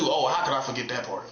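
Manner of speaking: usual speaking voice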